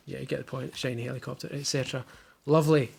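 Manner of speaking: normal talking voice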